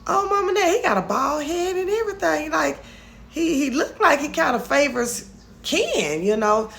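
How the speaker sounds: high-pitched gossiping retelling of Amber; Amber retold. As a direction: tell Mama Nat the news in a high, put-on voice. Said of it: "And so she said".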